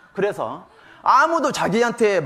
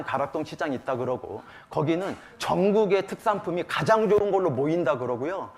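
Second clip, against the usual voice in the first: ordinary delivery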